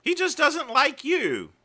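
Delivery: high, loud jab at the screen; exasperated retort. Real, not acted. Streamer yelling back at the clip's speaker in exasperation. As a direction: scold him, incredulous. Interrupting clip to insult speaker.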